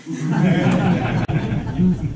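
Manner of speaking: a real loud laugh. Real, not acted. Laughing at long visit.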